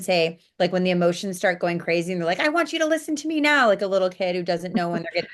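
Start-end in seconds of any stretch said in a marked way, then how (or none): 2.4-3.7 put-on demanding child. Inner child demanding attention.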